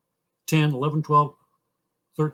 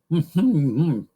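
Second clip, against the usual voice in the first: short loud hum that falls away; closed-mouth funny voice; 5 dB louder than usual. Shown as a demonstration. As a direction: say it with lips shut, wordlessly. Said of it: "funny voice I ever liked".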